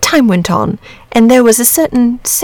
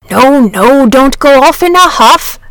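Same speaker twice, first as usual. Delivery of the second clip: louder, hurried dragon plea; hasty; 6 semitones higher, 6 dB louder, slower than usual. A narrator's reading. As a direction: rush to stop him leaving. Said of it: "said the dragon hastily".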